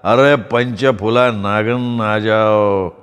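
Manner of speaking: put-on slow, drawn-out drunken babble; drunken mumble. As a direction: slur it like a drunk snake charmer calling. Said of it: "त्याच तालात तो बडबडला".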